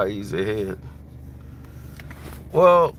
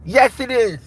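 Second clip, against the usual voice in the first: much higher, louder menacing boxer voice; Mike Tyson; 13 semitones higher, 6 dB louder than usual. Retold or imitated. Tyson threatening the man who robbed him, high and loud. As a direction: threaten him like the champ, pitched way up. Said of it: "They're gonna be like".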